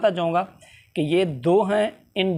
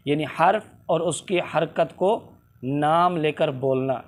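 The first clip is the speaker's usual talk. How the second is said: normal talking voice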